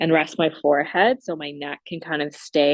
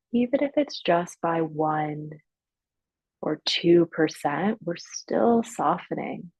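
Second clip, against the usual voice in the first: guided meditation. Real, not acted. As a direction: ease them gradually into stillness, leaving long silences. Leads guided breathing and relaxation.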